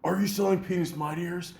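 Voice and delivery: high, loud, frantic Cage impression; Nicolas Cage. Cage impression interrupting the quiz show, high and frantic. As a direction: burst in with manic urgency. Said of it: "being Nicolas Cage".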